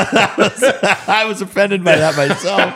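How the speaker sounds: high, loud words broken by laughter; cracking up at own joke; laughing hard. Real, not acted. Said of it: "Oh, I'm crying".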